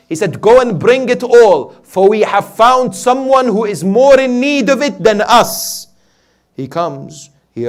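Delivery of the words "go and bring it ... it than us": high, loud preacher's retelling of an order; impassioned command. Retold or imitated. Imam orders his servant to bring everything, impassioned command. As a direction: send the order ringing through the hall. Acts Imam's generous command.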